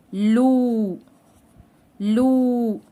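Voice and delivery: "لو" with a long, held oo; long waw madd. Shown as a demonstration. Teacher showing lam with waw madd, drawn-out "لو". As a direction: stretch the vowel of "لو" evenly, each time. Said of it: "اللام مع المد بالواو".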